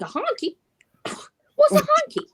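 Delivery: high-pitched, incredulous questioning; hammed-up comic bewilderment; baffled disbelief. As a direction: play dumb, squeaking each question through laughter. Acts out baffled reply to insult.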